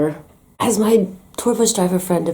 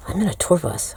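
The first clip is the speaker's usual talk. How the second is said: ordinary delivery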